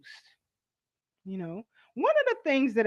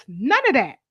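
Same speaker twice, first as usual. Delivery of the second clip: ordinary talk, nothing marked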